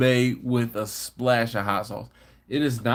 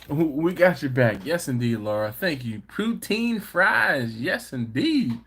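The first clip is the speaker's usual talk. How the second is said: normal talking voice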